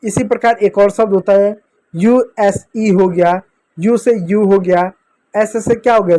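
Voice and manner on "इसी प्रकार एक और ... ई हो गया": normal talking voice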